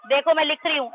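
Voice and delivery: ordinary delivery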